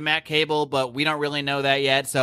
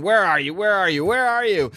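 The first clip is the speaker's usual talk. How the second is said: each repeat climbs higher and louder; building shout; 6 semitones higher, 4 dB louder than usual. Retold or imitated. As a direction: start loud, push up and louder each time. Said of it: "He's screaming".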